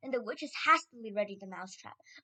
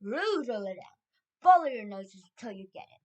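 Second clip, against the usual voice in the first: loud, commanding orders; Grand High Witch; 10 dB louder than usual. A narrator's reading. Leader sending witches hunting, loud commands. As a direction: bark out each order loudly. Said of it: "screamed the Grand High Witch".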